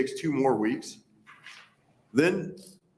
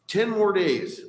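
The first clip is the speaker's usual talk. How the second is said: exasperated; 8 semitones higher, 5 dB louder, slower than usual. Real, not acted. Exasperated echo of proposal.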